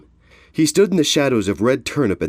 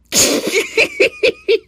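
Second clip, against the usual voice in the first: very high-pitched cackling laugh; guffaw; 5 dB louder than usual. Acted. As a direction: burst into loud, helpless laughter. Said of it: "Red Turnip guffawed".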